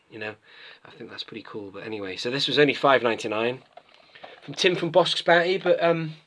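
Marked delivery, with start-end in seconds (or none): 4.4-6.1 high-pitched teasing imitation of a friend; mock high voice, poking fun; Tim. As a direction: put on a higher voice to mimic him. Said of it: "you do sound like that".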